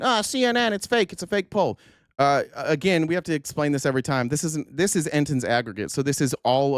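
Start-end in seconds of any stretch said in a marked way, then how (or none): 0.0-1.8 a higher, mock-whiny voice of the critics. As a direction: mimic the CNN-haters lightly, sneering. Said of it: "cue the people saying".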